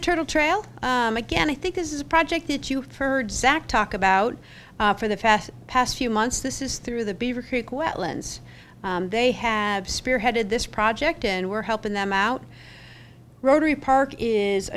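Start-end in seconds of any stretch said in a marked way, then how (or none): none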